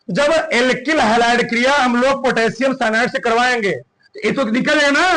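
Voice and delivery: ordinary delivery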